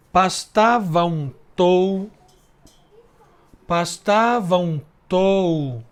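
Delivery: slow, syllables pulled apart; slow scansion. Shown as a demonstration. Teacher scanning the verse line for the class, slowly. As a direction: count the syllables aloud slowly. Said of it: "Outra lisão".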